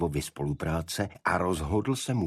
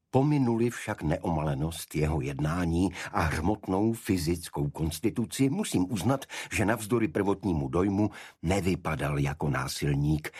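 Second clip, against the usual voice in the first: plain reading voice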